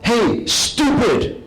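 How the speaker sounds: high, loud mock yell of an insult; yelled taunt from a stranger on the street; shouting. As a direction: heckle him from behind the crowd. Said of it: "they shout".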